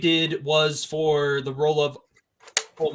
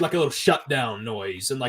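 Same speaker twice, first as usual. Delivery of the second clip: usual speaking voice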